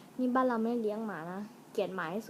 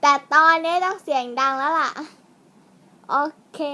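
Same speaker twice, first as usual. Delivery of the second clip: much louder, projecting over the room; loud, raised voice to cover background noise; speaking loudly; 3 semitones higher, 13 dB louder than usual. Real, not acted. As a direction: push the voice out loud on purpose. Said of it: "ไม่ค่อยได้เสียงดังอะไรมากมายเท่าไหร่".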